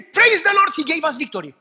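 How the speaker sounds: loud, high shout of praise; triumphant shout. Acted. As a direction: cry it out in victory. Preacher acts out triumphant shout.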